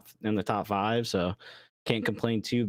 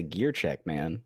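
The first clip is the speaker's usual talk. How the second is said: teammate reminder; 7 semitones lower, slower than usual. Retold or imitated. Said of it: "gotta have somebody always say".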